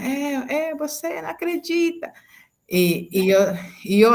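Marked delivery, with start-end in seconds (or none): 0.0-2.1 put-on high, childlike voice, much higher; teacher echoing the girl in a child's voice; imitating the girl. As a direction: mimic the crying girl's complaint. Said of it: "Y yo decía".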